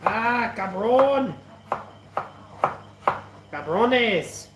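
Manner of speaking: mock Mexican accent. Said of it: "you want me to do a Mexican accent".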